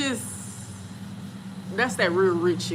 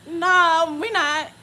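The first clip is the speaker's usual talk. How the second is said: higher, more drawn-out mock denial; oblivious denial; 8 semitones higher, much slower than usual. Imitating oblivious friend insisting there's no chase, emphatic. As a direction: insist on it, stretching out the no. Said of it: "She's so oblivious to everything".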